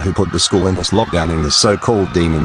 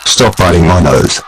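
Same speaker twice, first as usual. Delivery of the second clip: panicked shout; 7 dB louder than usual. Acted. Being attacked, shouting.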